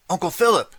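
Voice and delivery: high, loud cry of horror; horrified cry. A narrator's reading. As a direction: scream his name in shock. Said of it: "cried Justine in horror".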